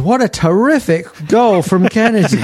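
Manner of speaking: high, bright commentator exclamation; mock commentator excitement. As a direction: gush like a TV pundit. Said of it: "what you can say is".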